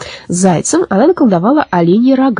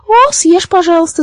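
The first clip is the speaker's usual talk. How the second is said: laughing; 11 semitones higher than usual. A narrator's reading. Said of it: "засмеялась маленькая Баба-Яга".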